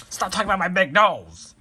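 high, loud furious yell; angry shout. Acted. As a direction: snap back, fed up with the teasing. Squidward mocked about his nose.

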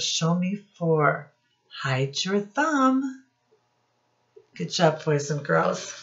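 ordinary delivery

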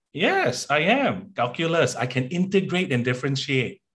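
high, eager acted answer; eager math whiz. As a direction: pipe up proudly, pitched high.

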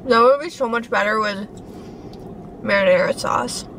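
eating while speaking; talking while chewing. Real, not acted. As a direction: mouth partly full of food. Seen 0: Bites curd at -1, lips working and chewing while talking.

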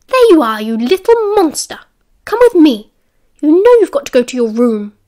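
loud, high scolding yell; angry matron shouting. A narrator's reading. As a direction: bark orders at the caught boy. Matron drags the boy out.

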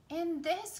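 high, loud eager exclaim; match her friend's find. Acted. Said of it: "Coral exclaimed".

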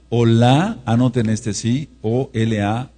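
ordinary talk

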